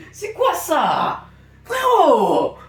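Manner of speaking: high, drawn-out stunned cry; high shocked yell, falling at the end; incredulous shout. Real, not acted. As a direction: stretch it out loud in disbelief. Shocked reaction to the video.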